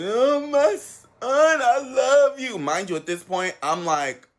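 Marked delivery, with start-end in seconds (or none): 0.0-2.6 high, drawn-out mock wail; hammed-up sobbing declaration; imitated crying. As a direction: wail it slowly like a tearful mother. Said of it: "and she start crying".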